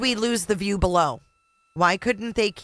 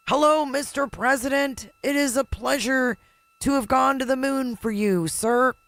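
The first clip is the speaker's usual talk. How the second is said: high, crackly radio voice; like a scratchy old transmission; crackly radio reply; 8 semitones higher than usual. Retold or imitated. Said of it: "Kinda like the crackle on Nixon's call".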